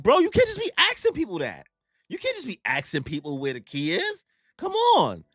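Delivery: high-pitched mock outrage, dipping then jumping up; imitated squawk of protest; exasperated scolding. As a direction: tell off a friend in acted disbelief. Retold scolding of Luffy.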